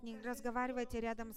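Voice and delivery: ordinary talk, nothing marked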